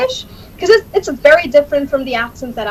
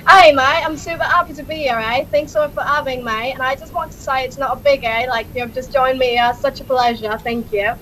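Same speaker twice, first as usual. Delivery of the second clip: Australian accent. Shown as a demonstration. Demonstrating accents for the host, Australian accent. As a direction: thank them in an Australian accent.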